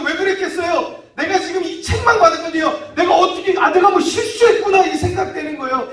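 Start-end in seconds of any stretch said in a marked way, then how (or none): 3.0-4.8 high, loud, startled self-reproach; alarmed realisation. Acted. Father realising his mistake after the rebuke, alarmed. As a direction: blurt it out in alarm.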